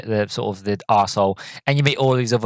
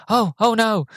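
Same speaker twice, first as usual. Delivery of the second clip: mock frightened gasp; 11 semitones higher than usual. Girl in car scared.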